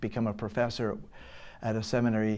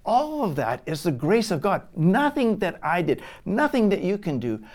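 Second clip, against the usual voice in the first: louder, higher, pressing each point home; preaching at full force; 4 semitones higher, 6 dB louder than usual. Acted. Preacher driving home grace to the church, forceful. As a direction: preach it hard to the congregation.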